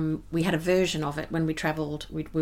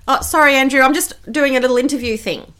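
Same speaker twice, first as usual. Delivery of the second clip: loud, higher call to someone away; 9 semitones higher, 13 dB louder than usual. Real, not acted. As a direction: raise your voice across the house. Calls back to interrupting Andrew.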